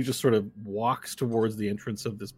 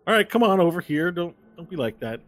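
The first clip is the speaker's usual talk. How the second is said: higher, louder call across a room; [name]'s in-character voice; 7 semitones higher, 6 dB louder than usual. Acted. [name] waves a newcomer over to the table, higher coaxing call. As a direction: beckon someone over, starting high and loud. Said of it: "and he goes".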